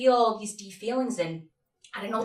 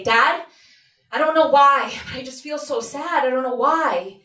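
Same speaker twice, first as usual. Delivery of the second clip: higher, louder anxious plea; 4 semitones higher, 6 dB louder than usual. Acted. Seven-year-old confiding in dad, anxious. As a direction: blurt it out, unsettled and insistent. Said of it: "with all this anxiety".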